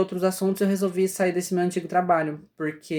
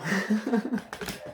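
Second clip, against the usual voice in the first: short, softer amused laugh; 3 semitones higher, 5 dB quieter than usual. Real, not acted. Speaker amused by the chaos mid-lesson, laughing. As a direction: laugh at your own mishap.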